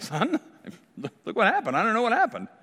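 high, laughing, playing dumb; mock innocent Aaron. Aaron excusing the golden calf to Moses, mock innocent high voice. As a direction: squeak it out like a caught kid. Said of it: "he makes it sound like".

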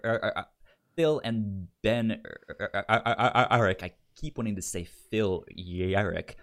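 usual speaking voice

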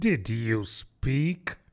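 slow, very polite question; frowning politeness. A narrator's reading. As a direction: ask slowly, with pointed courtesy. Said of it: "but very politely".

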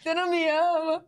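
high, slow mock-hurt whine; queixa magoada encenada. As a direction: replay a wounded reproach, drawn out. Replays own pregnancy reproach to husband. (mágoa dramatizada, aguda e arrastada)

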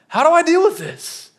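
high-pitched tense plea; tense inner voice. Retold or imitated. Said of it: "I just feel this kinda tension".

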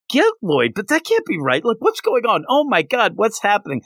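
high-pitched mock bewilderment; retold flustered disbelief, pitched well up; bewildered. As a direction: act out being lightly panicked and confused. Retold bewildered inner panic.

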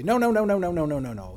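a loud, high, repeated no; a teacher cutting in with a loud no, no, no; emphatic no's. Retold or imitated. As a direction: stop them with a run of firm no's. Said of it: "I'll say".

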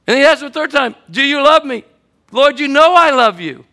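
high, loud preaching voice; full preaching. Acted. Pastor telling the congregation of Peter's restoration, full preaching. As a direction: preach it to the back row.

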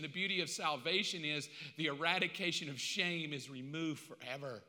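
very soft, low and gentle; tender forgiveness. Acted. God forgives his son Mark, soft and fatherly. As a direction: hush it like a blessing.

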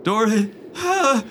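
shrill cry while falling; panicked high yell; high scream. Acted. NPC falling.

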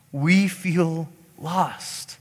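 very slow, drawn-out words; heartfelt lament. Acted. Preacher voicing how we feel, slow lament. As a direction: linger on each word.

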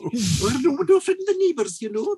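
high, hammed-up Scottish old-lady voice; mock Scottish accent. Joke-teller redoing the widow worried about neighbours, mock Scottish accent. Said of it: "that's a bit Fife".

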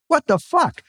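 high, stunned exclamation; shocked outburst. A narrator's reading. Stunned man learning she is Israeli, high shocked blurt. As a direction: blurt it out in disbelief.

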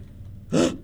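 a sharp, breathy gasp of shock. Retold or imitated. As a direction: gasp as if caught off guard. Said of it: "and I was just like".

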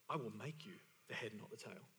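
low, very soft, solemn; hushed promise. Acted. Preacher voices God to his people, hushed. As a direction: drop right down, almost under the breath.

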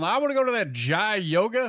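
mispronouncing customer. Pretend. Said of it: "you're gonna have people going".

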